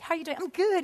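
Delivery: high, bright-pitched fake-fine greeting; cheery small talk. Churchgoer hiding behind a fine facade, cheery small talk.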